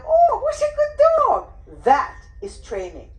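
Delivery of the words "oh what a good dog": much higher, louder gushing praise; squeaky good dog praise. Shown as a demonstration. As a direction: gush over the dog. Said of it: "and say to them".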